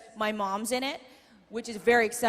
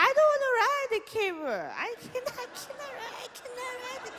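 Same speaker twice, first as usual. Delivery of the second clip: very high, softer mock whimper; mom's frightened whine; 10 semitones higher, 4 dB quieter than usual. Mom refusing to ride the camel, hammed-up whimper. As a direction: plead like a scared spoiled mother. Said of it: "I said".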